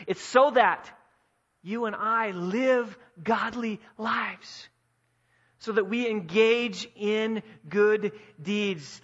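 loud, then slow and deliberate on each word; emphatic preaching. Acted. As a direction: land the point slowly and firmly. Preaching at full force.

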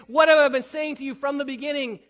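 loud exasperated repeat of "What have I been saying"; fed up, hammer the question; frustrated. Acted. Jesus pressing the Pharisees, loud frustrated question. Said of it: "getting a little frustrated".

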